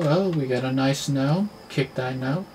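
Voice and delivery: ordinary talk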